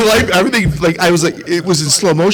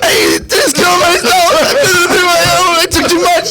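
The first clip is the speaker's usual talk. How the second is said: laughing cry; 12 semitones higher than usual. Retold or imitated. Son crying to his mom on the phone. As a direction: wail it half crying, half laughing. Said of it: "I go".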